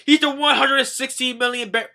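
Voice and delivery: high, loud alarmed shout; hammed-up urgent holler; Neptune yelling. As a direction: yell it through the line, acting it out. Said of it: "Neptune's yelling through the".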